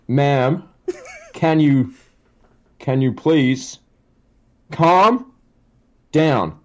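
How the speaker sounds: slow deadpan, pausing between phrases; deliberate, unhurried mock patience; Randy Orton. Telemarketer asking hysterical caller to calm down, slow deadpan.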